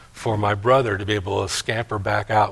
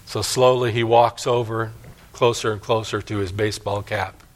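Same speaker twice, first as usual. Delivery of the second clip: ordinary talk, nothing marked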